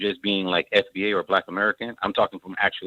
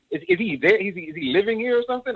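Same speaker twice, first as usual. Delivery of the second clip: high-pitched, incredulous questioning; amazed disbelief; 11 semitones higher than usual. Retold or imitated. Listener recalling his reaction to the host, in high-pitched amazement. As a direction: re-enact your baffled reaction, pitched way up.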